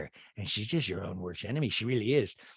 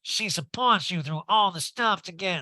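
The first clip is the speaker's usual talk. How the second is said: Garcelle; 7 semitones higher, 3 dB louder than usual. Retold or imitated. Recapper voicing a friend defending her loyalty, put-on protest. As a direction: stretch it out in a higher voice. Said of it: "Garcelle goes".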